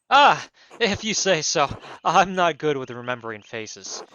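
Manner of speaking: loud, booming noble voice; baron voice. Acted. Baron barging into the abbey, booming and pompous. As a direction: announce yourself like a pompous lord. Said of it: "these fucking wild accents".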